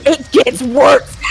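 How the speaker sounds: loud, high, stretched out through laughter; breaking into laughter. Real, not acted. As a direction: barely get it out before cracking up. Breaking into laughter while telling story.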